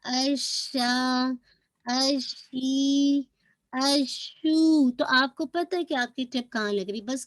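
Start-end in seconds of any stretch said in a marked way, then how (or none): 0.0-4.9 अशा, अशी, अशू each very slow, the sh held; sheen articulation. Shown as a demonstration. Teacher drills the sheen sound, slow recitation. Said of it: "शीन के मखरज के पास".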